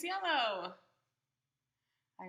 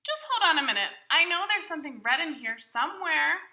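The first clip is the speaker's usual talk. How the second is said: higher, louder, cheerful Big Bird puzzling over his bag; 5 semitones higher, 13 dB louder than usual. Acted. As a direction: play a big friendly bird talking to the child. Reader voicing Big Bird's lines.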